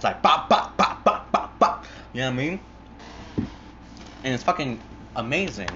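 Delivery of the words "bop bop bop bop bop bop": rapid-fire percussive "bop" noises; imitated gunshots. Two hitmen shooting their victim, imitated gunshots. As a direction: punch out mock gunfire bursts. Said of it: "just fucking caps his ass".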